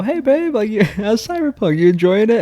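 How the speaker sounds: high, chirpy retold chat; upbeat and oblivious, pitched well up; cheerful greeting. Husband greets partner mid-game, all cheerful. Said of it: "I'm all cheerful".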